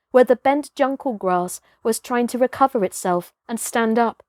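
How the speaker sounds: ordinary delivery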